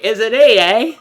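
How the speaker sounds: loud jokey Canadian with a tagged eh; hammed-up Canadian asking is it A, eh; Canadian accent. Mock Canadian accent for the puzzle answer. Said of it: "I busted, like, a Canadian".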